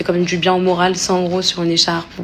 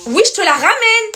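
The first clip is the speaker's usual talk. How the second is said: playful threat; 12 semitones higher, 5 dB louder than usual. Pretend. Repeats threat, high and loud.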